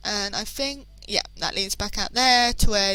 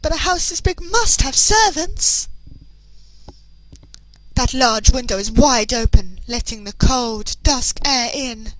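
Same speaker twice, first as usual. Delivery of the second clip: lightly voiced posh Victorian gentleman; a hint of a refined, effeminate young gent reading the scene; narrating as a posh young gentleman; 3 semitones higher, 6 dB louder than usual. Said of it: "He's a bit of an effeminate sounding gentleman".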